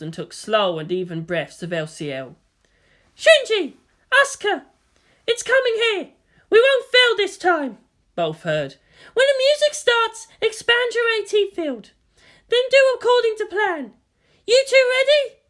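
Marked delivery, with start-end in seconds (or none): 3.2-7.8 high, loud rallying call; Misato calling. A narrator's reading. Misato to Shinji and Asuka before launch, high and loud. 9.1-15.4 high, loud rallying call; Misato calling. A narrator's reading. Misato to Shinji and Asuka before launch, high and loud.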